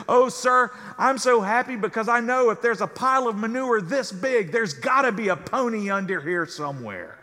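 can't believe what he's seeing; baffled professor. Acted. Professor asking boy on manure pile, high and astonished.

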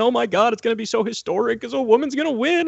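high, gushing mock voice; mock gushing elite. Mocking the DC elite's giddy certainty in a high voice. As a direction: gush high like a giddy insider.

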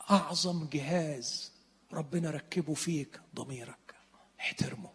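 slow, hushed whisper. Acted. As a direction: lean in and whisper it like a secret. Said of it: "preacher drops to whisper".

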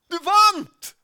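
explosive high-pitched shout of news; triumphant shout. Retold or imitated. Red-faced boy running up the stairs at the winner, shouting. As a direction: yell it at full volume, very high. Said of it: "Og så roper han".

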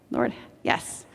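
soft whisper to the Lord; whispered prayer. Real, not acted. Preacher whispering a quick prayer to the Lord. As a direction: breathe it as a private prayer. Quiet aside to God.

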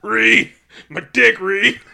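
high, loud, comic yell; repeating the rock monster's cry, loud and laughing; mock yelling. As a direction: yell it right into their ear. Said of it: "this man yelling in my ear".

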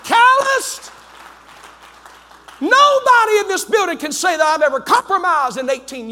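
high-pitched, impassioned pulpit shout; full-force preaching. Real, not acted. Preacher warning people falling over the cliff, full-force preaching. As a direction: hammer each refrain home to the congregation.

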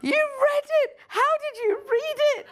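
can't believe his hero read his book; starstruck disbelief. Retold or imitated. Fan asking Bruce about his book, high excited squeal. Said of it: "And he's going".

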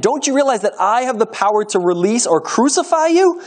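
high, insistent challenge; demanding. A narrator's reading. Pilate warns the silent prisoner of his power, demanding. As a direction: press him with your authority. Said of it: "Pilate demanded".

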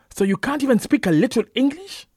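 very high, loud challenge; indignant shout. A narrator's reading. Preacher boy challenging Isiaku's Hausa reply, loud indignant shout.

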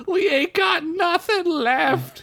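put-on very high old elf begging; old elf begs the knight; groveling elf. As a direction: grovel desperately. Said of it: "he cried".

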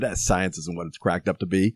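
low, offhand brush-off; dismissive shrug. Retold or imitated. The president brushing off the aide, low offhand dismissal. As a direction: toss it away like it's nothing. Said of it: "snapped the towel and said".

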